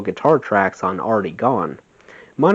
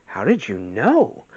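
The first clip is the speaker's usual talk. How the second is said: aghast; 2 semitones higher, slower than usual. Retold or imitated. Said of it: "asked aghast".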